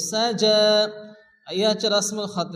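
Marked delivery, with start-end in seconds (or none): 0.1-0.6 সাজা with short first syllable, long "jaa"; measured tajweed সাজা, ending drawn out; elongated vowel. Shown as a demonstration. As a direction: hold the last vowel of সাজা one measure. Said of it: "এক আলিফ পরিমাণ টান দিয়ে পড়তে হবে".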